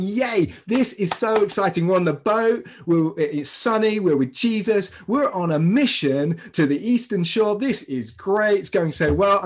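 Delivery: acted-out burst of eager delight; really excited. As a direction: play the thrilled adventurer setting off. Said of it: "I can get really excited".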